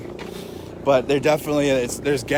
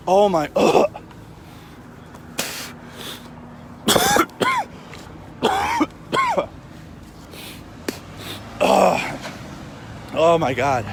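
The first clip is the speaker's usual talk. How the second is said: choking on tear gas; 3 semitones higher, 4 dB quieter, slower than usual. Real, not acted. Coughing in tear gas cloud.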